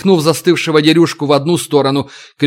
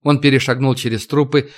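plain reading voice

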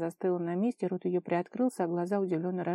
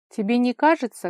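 raised voice, higher pitch; loud question; 5 semitones higher, 9 dB louder than usual. A narrator's reading. Kolya calling to Vara while washing, loud. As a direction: ask it out loud over the splashing. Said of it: "громко спросил он".

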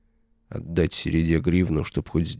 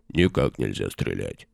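much lower, heavy bass warrior; deep bass voice; 10 semitones lower, 3 dB quieter than usual. A narrator's reading. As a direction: drop into a low bass. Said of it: "басом подтвердил второй воин".

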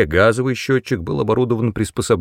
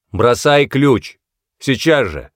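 louder, higher police order; sharp command; 4 semitones higher, 4 dB louder, slower than usual. A narrator's reading. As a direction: order him to drop it at once. Policeman orders man to drop wrench. (резкий громкий приказ)

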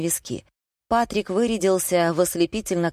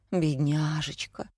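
soft, slow cooing pity; fussing sympathy; 5 dB quieter, slower than usual. A narrator's reading. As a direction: coo over someone unwell. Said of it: "засуетилась Софья".

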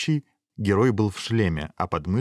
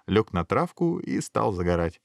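plain reading voice

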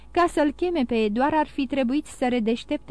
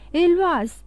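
higher-pitched cry of a name; raised, urgent narrated call; 6 semitones higher, 4 dB louder than usual. A narrator's reading. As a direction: call out for someone behind a door. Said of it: "strigă el".

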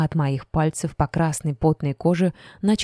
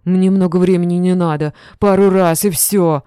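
leer at her, drawing it out loud; leering drunk; 2 semitones higher, 7 dB louder than usual. A narrator's reading. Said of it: "голос пьяницы".

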